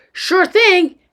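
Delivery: high, loud, ready reply; eager agreement. Acted. Boy takes dad's hint to escape, eager agreement. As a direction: jump at the chance.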